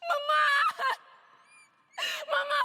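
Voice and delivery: high sobbing screams; terrified screaming. Acted. Girl screaming for her dead mama and her papa, terrified. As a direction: scream for your life.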